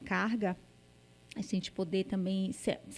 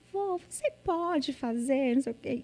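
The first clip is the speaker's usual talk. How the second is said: daughter's little voice; 5 semitones higher than usual. Retold or imitated. Daughter sweet-talking her grandpa, imitated little voice. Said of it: "ela fala aquela voz assim".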